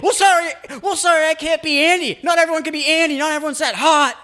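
high, loud mocking whine; jealous boyfriend. Jealous boyfriend snapping at girlfriend, high whiny mockery. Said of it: "he's gonna be like".

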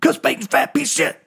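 high, bolder yelled taunt; yelled insult. Retold or imitated. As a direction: yell the insult up high, egging him on. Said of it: "Larry yells out".

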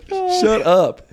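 high, loud mock outburst; retold annoyance. Silencing a stranger at the concert, mock outraged.

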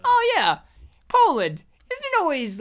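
high, falling mock-dismissive voice; lightly imitated scornful actor; Seth Rogen. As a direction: start high and swoop down dismissively. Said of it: "Seth Rogen upset her by saying".

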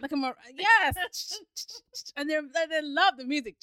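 losing it completely; laughing fit. Real, not acted. Laughing fit over maraca joke.

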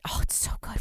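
breathy whisper. Real, not acted. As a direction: whisper it lovingly. Gushing about the movie.

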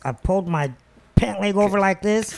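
ordinary delivery

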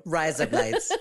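mock Aussie accent, close to laughing; put-on Australian vowels. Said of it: "say in an Australian accent".

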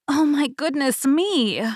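high-pitched little gasp of words; startled cry. A narrator's reading. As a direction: cry out in alarm, slowly. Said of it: "cried Goldilocks".

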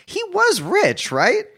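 high, slow incredulous question; can't believe he bought cheap vodka; baffled. Real, not acted. Asking about the mayor's wealth, high and baffled.

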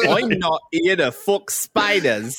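slow, high, badly faked Aussie; way-off Australian accent. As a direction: ham up a terrible Australian accent. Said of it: "There you're way off".

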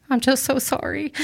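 fighting back real tears; voice breaking. Real, not acted. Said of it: "I was gonna try not to cry".

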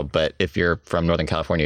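usual speaking voice

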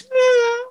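imitated high, thin hen whimper; little whimper. Imitating the hen who just sits there, high little whimper. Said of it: "She makes this little whimpering noise".

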